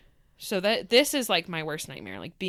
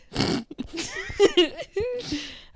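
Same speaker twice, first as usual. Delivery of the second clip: high giggling laugh; real giggle; 13 semitones higher, 3 dB louder than usual. Real, not acted. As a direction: let a high laugh roll out. Laughing before starting topic.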